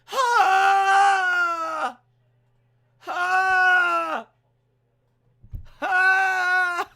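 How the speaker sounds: high, loud repeated yelps; hammed-up bad roar; rubbish roar. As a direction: bark out silly little roars. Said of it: "come down and go".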